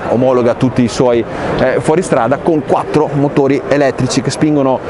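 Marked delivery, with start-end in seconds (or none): none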